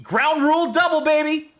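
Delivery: high excited brag; excited cheer. Real, not acted. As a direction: gloat over the hit. Celebrating a hit.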